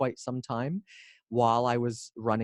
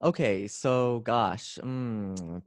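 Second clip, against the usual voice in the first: normal talking voice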